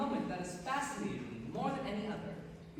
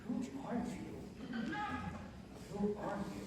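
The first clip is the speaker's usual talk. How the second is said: inner bewildered thought; 3 semitones higher, 5 dB quieter, slower than usual. Retold or imitated. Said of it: "you think to yourself".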